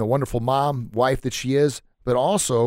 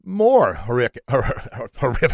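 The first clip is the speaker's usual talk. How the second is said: laughing stumble. Real, not acted. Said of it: "I can't even talk here".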